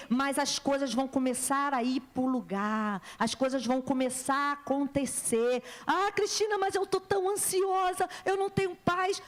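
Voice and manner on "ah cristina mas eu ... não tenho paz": high-pitched mock complaint; anxious objector. Congregant pleading to the preacher, anxious objection. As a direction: imitate a worried church member, voice lifted high.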